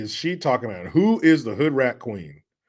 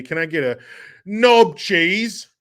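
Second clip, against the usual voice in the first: higher, louder mock yell; hammed-up catchphrase voice; 5 semitones higher, 6 dB louder, slower than usual. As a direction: punch it out as a silly shout. Performs requested silly catchphrase for chat.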